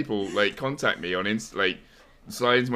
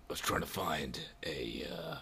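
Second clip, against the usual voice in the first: low, whispered American game-hero voice; sore-throat whisper, badly passing for American; Solid Snake; 3 semitones lower, 9 dB quieter than usual. Host tries to prove his American accent, low whispered impression. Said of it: "I think I can copy Snake from Metal Gear Solid".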